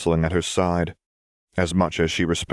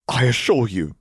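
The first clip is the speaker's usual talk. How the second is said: firm, raised insistence on assure; insistent assurance; 6 semitones higher than usual. A narrator's reading. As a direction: press the word assure up and firm. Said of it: "she began".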